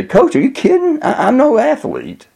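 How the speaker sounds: put-on very high, disbelieving boy; incredulous protest. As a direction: protest to the coach, pitch leaping up. Boy answers coach in disbelief.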